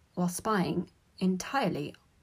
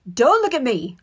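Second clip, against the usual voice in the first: loud, high, sharp refusal; loud irritated outburst; snapping; 6 semitones higher, 12 dB louder than usual. A narrator's reading. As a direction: snap back defensively. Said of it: "Ron snapped".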